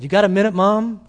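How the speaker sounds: teasing echo of her question, called down the hall; son calling after. Retold or imitated. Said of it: "the son opened the door and said".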